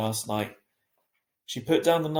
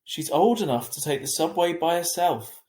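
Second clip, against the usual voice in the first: insisting; 5 semitones higher, 5 dB louder than usual. A narrator's reading. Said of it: "Dad insisted".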